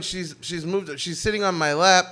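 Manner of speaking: usual speaking voice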